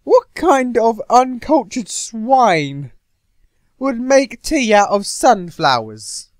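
a fierce fish warrior scandalised, slow and loud; loud, slow, put-on voice of a furious warrior; outraged warrior. Voicing Undyne's outraged rant.

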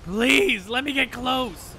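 high, loud gamer plea; loud pleading. Real, not acted. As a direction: beg at full voice. Pleading with the game mid-fight.

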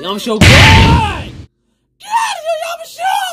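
very high, loud screech; screaming and yelling. Acted. Yelling Yamashiro away mid-battle, high-pitched scream. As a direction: scream at them to get out. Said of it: "Stop screaming and yelling".

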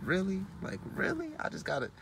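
soft, disbelieving "really" that leaps up high; quiet sarcastic disbelief; squinting back in quiet disbelief; incredulous. Retold or imitated. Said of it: "I give him that look".

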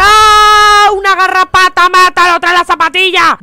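high, loud panicked screaming; terrified scream. Real, not acted. Gamer sees a tick in the game, terrified screaming. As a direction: scream in real terror.

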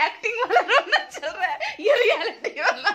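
high, laughing teasing about fake crying. Real, not acted. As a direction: laugh while mocking the acting. (रोने की acting पर हँसते हुए चिढ़ाना)

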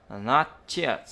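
slow "not yet" linked as not-chet; nối âm ch. Shown as a demonstration. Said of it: "thì đọc là". (đọc chậm "not yet" thành not-chét)

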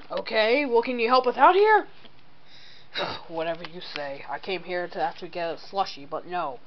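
usual speaking voice

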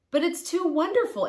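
high, amazed and disbelieving; a storybook Abraham who can't believe his luck; marvelling, pitched well up. A narrator's reading. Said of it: "Abraham said".

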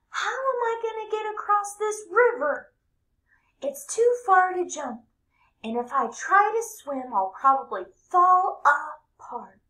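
high, loud fretting cartoon voice; high squeaky cheese man, anxious; worried cheese man. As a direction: fret like a small panicky runaway.

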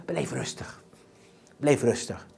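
very soft, low voice; hushed calming. Real, not acted. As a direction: soothe them down almost under the breath. Models staying calm, voice drops.

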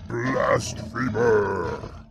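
slow, loud furious roar. A narrator's reading. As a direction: bellow it out, outraged king. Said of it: "King Bromwin roared".

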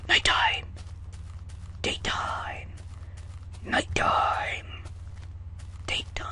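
hushed, awestruck whispers; awed whisper. Acted. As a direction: breathe each word in wonder. Marvelling at sky changing.

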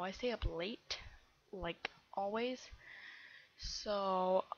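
ordinary talk, nothing marked

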